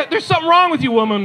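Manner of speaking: high-pitched mock scolding; hammed-up husbandly dismay; appalled scolding. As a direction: tell off your wife in disbelief. Husband scolding wife in retold quarrel.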